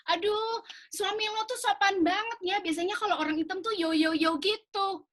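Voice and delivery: a light send-up of a friend's backhanded compliment; imitating a gushing friend. Friend gushing over her husband, mildly mock high voice. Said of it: "my friend would say to me".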